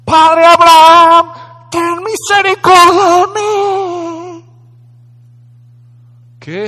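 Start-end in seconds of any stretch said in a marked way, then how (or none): 0.0-4.4 loud, slow, high desperate plea; crying out. Acted. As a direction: wail it out slowly, begging from afar. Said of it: "Y gritando dijo".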